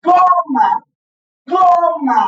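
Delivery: GO-ma pitched high and loud on GO; teacher modeling Goma's stress; stressed first syllable. Shown as a demonstration. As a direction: hit GO in Goma. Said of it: "¿Cómo la pronuncio?".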